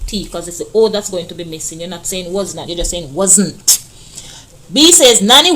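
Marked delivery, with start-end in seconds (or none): none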